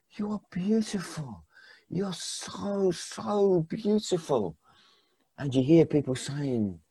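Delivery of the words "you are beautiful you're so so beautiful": soft, slow, tender murmur. Acted. Old man stroking his wife's face, tender and soft. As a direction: speak softly, as if overcome.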